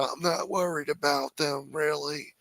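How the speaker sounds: lazy unbothered mock of Biden; imitating a careless shrug of a voice. Mocking Biden.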